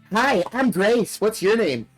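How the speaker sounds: high, slow creepy doll voice; sing-song haunted doll, drawn out; talking doll. Acted. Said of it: "its mouth begins to move".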